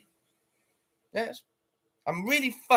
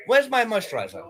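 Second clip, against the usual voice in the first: usual speaking voice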